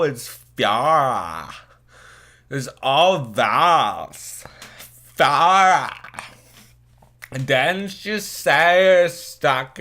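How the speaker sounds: high, slow put-on Fran voice; the tipsy girl drawls her name in the Fran voice; Fran-style girl voice. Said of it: "she is going to sound like Fran from The Nanny".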